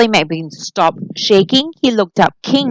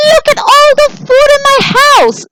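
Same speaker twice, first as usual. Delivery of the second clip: high exasperated cry; 6 dB louder than usual. Acted. Charlie dismayed at wasted food.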